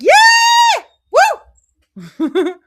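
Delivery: high, loud whooping cheer; excited cheer. Real, not acted. Cheering Jesus on in the temple, loud whoop. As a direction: shout it like a fan in the stands. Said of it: "Get excited".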